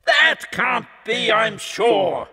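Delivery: high, sneering devil's refusal; a devil who scorns the poor; Satan crying out. A narrator's reading. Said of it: "Cried Satan".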